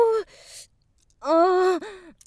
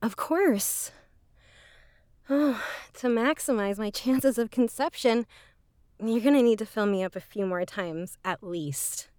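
spent afterglow; 7 semitones lower, 5 dB quieter than usual. Acted. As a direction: lower and softer, a sated purr. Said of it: "I can't trust my legs yet".

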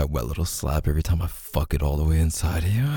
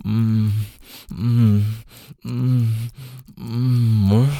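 building moans; 5 semitones higher, 4 dB louder, much slower than usual. Acted. Rising moans near climax.

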